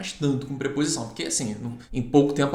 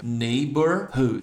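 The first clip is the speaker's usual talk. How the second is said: "Neighborhood" drawn out syllable by syllable; "Neighborhood" broken down slowly for learners; slow word demo; 3 semitones lower, much slower than usual. Shown as a demonstration. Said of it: "Palavrão aqui, ó".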